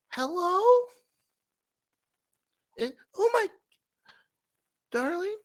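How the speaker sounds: put-on high, quavering little old lady voice; a dithering old lady calling out, pitched high. Old lady in the hallway calling to her darling, high and quavering.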